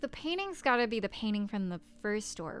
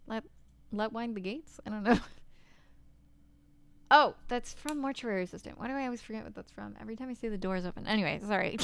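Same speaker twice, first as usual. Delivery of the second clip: ordinary talk, nothing marked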